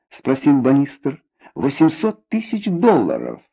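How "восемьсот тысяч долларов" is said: solemn announcement. A narrator's reading. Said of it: "торжественным голосом объявила Надя".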